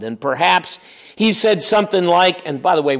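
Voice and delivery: ordinary delivery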